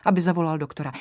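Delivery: ordinary delivery, nothing marked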